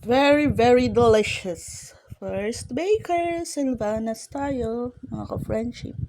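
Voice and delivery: loud, drawn-out hype about the food; excited praise. Real, not acted. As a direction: sell it like a big treat. Hyped food praise to viewers. (masiglang papuri sa pagkain, malakas at mabagal)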